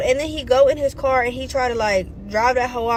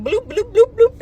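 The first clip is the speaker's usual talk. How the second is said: high, bouncing bloops for a stumble; comic tumbling noise; 8 semitones higher, 3 dB louder than usual. Retold or imitated. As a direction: imitate someone toppling backwards, pitch jumping up. Imitates the stumbling backwards.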